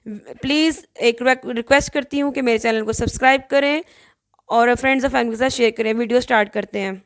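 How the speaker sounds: loud, bright sign-on to viewers, brisk at the end; upbeat channel intro. Acted. Vlogger greeting her viewers and asking them to subscribe, loud upbeat intro. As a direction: sell the channel like a host opening the show.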